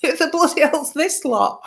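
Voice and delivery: startled outburst. Retold or imitated. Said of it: "And I went".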